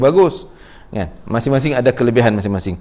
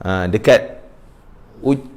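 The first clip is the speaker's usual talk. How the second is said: normal talking voice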